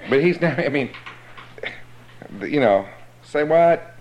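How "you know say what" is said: normal talking voice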